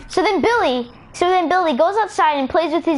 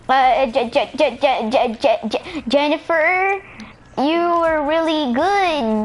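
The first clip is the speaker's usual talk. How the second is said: stuck on the first syllable, then slowly stretched out; acted stutter; slower than usual. Nervous Billy complimenting his crush Jennifer, acted stutter. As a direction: stammer like a tongue-tied boy with a crush. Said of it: "he talks with a stutter".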